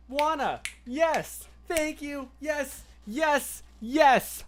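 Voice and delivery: high, loud eager yeses; excited agreement. Real, not acted. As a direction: cheer on the chatter. Excited reply to chat.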